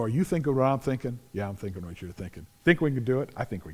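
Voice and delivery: low hushed back-and-forth between friends; hushed conspiring. Acted. Three mighty men plotting the raid, hushed and quick. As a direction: trade it quietly, like plotting a secret dare.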